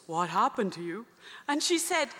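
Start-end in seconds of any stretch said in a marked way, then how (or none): 0.1-1.0 a deeper, measured churchman asking; lightly voiced cardinal; lower cardinal voice. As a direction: play the cardinal, pitched down.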